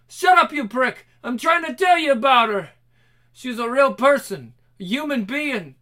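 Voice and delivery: high, loud angry yelling; furious shouting. Acted. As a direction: rage at him. Enraged grieving captor.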